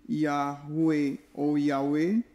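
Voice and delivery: Yahweh said very slowly, ya-hu-e then ya-we, each syllable drawn out; slow careful reading. Shown as a demonstration. Teacher showing how the second name is read, very slow. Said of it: "o segundo que é lido".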